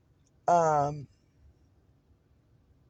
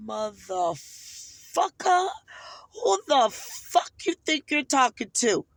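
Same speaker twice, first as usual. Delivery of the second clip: slow, drawn-out angry challenge; acting out losing your temper, word by word; retold outburst; 3 semitones higher, much slower than usual. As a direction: stretch each word as a furious confrontation. Acts out losing her temper.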